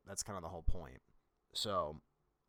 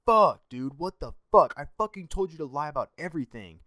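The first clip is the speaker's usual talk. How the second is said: loud, higher swearing outburst, retelling the recruiter's rant; retold angry yelling; 7 semitones higher, 11 dB louder than usual. Recruiter yelling down the phone at his recruit, loud and angry. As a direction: bark it at him like a furious recruiter on the phone. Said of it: "He, he just starts screaming at me".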